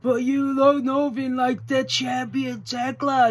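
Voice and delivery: high, drawn-out wrestling promo voice; Tekla. Retold or imitated. Fan mimics a wrestler's promo, drawn-out crowing impression. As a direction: crow it slowly and high, imitating a cocky champion. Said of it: "we actually get Tekla cutting a little promo".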